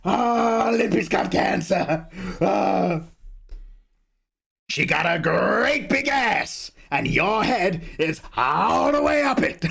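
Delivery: Al Pacino. Retold or imitated. Streamer's Pacino rising to a slow shouted punchline. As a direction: bellow it like the hammed-up old actor. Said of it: "See, we've got Al Pacino".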